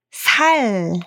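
ordinary talk, nothing marked

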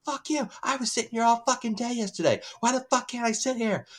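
high-pitched imitation of an outraged woman; mock screaming. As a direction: imitate her screaming without full volume. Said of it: "she starts screaming at him".

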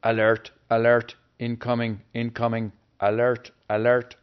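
loud alarm announcement; computerized warning voice. Acted. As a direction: blare it like a computer alert. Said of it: "the computerized voice scared him".

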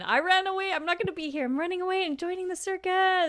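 hammed-up runaway declaration; playful proclamation. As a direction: sing out the runaway's news with glee. Listener grandly replays the runaway line.

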